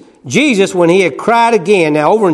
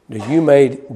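ordinary delivery

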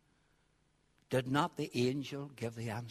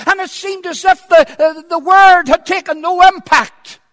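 loud, high-pitched preacher's cry; impassioned shouted sermon; full-force preaching; 14 semitones higher, 23 dB louder than usual. Acted. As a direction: thunder it out from the pulpit, stretching key words.

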